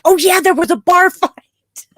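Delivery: high, loud burst of recall laced with laughter; excited realization. Retold or imitated. Co-host recalling the episode's bar brawl, excited realization. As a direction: blurt it out, tickled and loud. Acting out own sudden realization.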